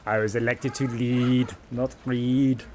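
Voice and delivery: slow, deadpan action-hero delivery; McBain. Retold or imitated. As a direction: quote it like a gruff movie cop. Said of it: "so I can quote The Simpsons and McBain".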